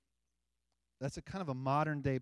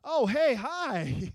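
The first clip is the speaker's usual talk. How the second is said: hammed-up startled hi, louder and higher; mock awkward greeting; 10 semitones higher, 8 dB louder, much slower than usual. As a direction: play the stiff churchgoer who barely knows them. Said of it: "it's not like one of those".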